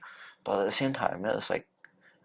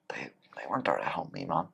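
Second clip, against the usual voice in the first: soft unvoiced whisper; whispered confession; 5 semitones lower, 8 dB quieter than usual. Real, not acted. Telling his friend nobody helped him, whispered. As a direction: let it drop to a hush, hurt. Confiding low moment.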